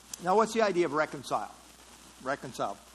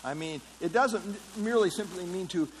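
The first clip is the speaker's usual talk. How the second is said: normal talking voice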